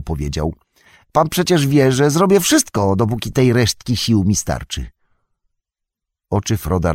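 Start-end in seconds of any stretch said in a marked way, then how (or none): 1.1-4.9 wyżej, przejęty, błagalny Sam; głos Sama, pełen litości. A narrator's reading. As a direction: Sam z litością prosi, że poniesie ciężar. Sam voiced by narrator. (Sam z litością ofiarowuje się nieść ciężar, głosem wyższym)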